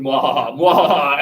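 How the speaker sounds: building villain laugh, mwahaha; evil cackle. Pretend. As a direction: cackle like a mad scientist. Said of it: "with each level of mwahaha, it gets more evil".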